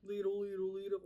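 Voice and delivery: understated, half-hearted cartoon chant; bad Patrick Star impression. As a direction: imitate someone's weak Patrick Star. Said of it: "his impression was not as good as mine".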